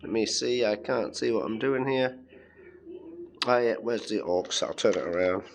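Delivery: ordinary delivery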